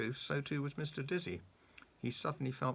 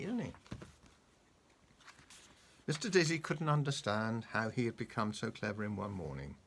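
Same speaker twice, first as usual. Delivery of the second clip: ordinary delivery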